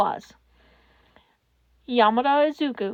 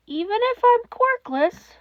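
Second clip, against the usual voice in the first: put-on timid child; 9 semitones higher than usual. A narrator's reading. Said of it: "child's fearful question".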